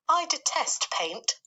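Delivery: high, slow, prim announcement; a fussy lady spider declaring her dislike; Miss Spider voice. Acted.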